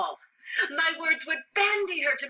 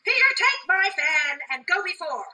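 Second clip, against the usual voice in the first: raised, louder servant's order; commanding; 4 semitones higher, 5 dB louder than usual. Acted. Nurse sending her servant ahead, commanding. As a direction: order him along loudly.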